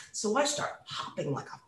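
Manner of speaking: usual speaking voice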